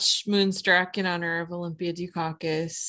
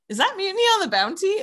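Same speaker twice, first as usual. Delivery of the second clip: much higher, a lightly voiced curious contractor; contractor's surprise question; 13 semitones higher, 4 dB louder, faster than usual. Contractor spotting the old film on screen, lightly voiced curious question. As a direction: pitch the contractor up, mildly surprised.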